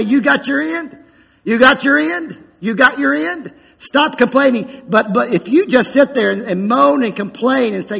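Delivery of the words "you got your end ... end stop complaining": higher, insistent repeated question; Spirit challenging. Acted. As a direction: press the question, higher each time. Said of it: "The Spirit will say".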